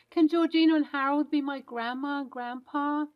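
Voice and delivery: put-on a little boy checking whether his grandparents are nice; hopeful child. Child asks a new grandma.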